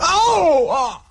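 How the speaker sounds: sharp high yelp of pain; high gasping cry of pain. Acted. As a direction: cry out as the plaster tears. Wound plaster ripped off.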